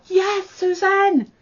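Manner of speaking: high, drawn-out excited holler of Yes, Susan; stretched joyful shout of the designer's name; hollered cheer. Retold or imitated. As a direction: cheer her name out long and high like a fan. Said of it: "like, hollered".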